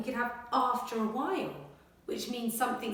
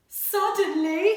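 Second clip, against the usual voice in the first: high, loud, drawn-out suddenly; big suspenseful reveal; dramatic suddenly; 9 semitones higher, 12 dB louder than usual. Acted. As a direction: make the kids jump on it. Dramatic story word.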